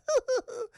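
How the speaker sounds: short high villain cackle; high mocking giggle from afar; Joker. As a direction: imitate a mad clown's laugh. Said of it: "he hears his laugh".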